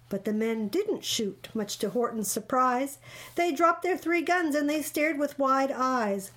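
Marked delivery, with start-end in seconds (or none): none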